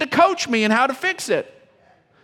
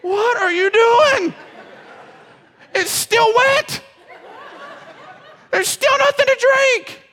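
much higher, louder and slow, a hammed-up incredulous yell; mock-scold the hugger in a shrill outraged squawk; exasperated leader; 12 semitones higher, 3 dB louder than usual. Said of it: "the leader is gonna look at the person giving me a hug going".

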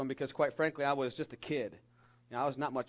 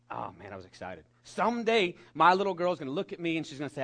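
normal talking voice

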